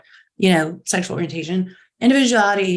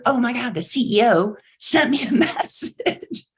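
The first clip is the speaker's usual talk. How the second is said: excited shout; 4 semitones higher than usual. Retold or imitated. Employee stunned the CEO messaged them, laughing excited shout. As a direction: shout it like you can't believe it.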